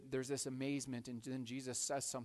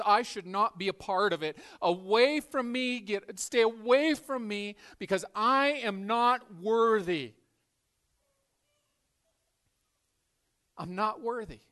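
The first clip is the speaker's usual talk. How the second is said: anguished plea; 7 semitones higher, 12 dB louder than usual. Acted. Peter pleading he is unworthy.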